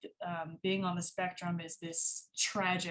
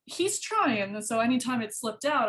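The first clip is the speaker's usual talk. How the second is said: ordinary talk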